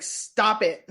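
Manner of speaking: a mock yell, like mom laying down the law. Investigator ordering the box-mover to quit, retold mom voice. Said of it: "like, mom voiced it".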